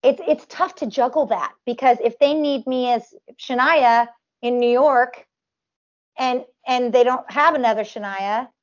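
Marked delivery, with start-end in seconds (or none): none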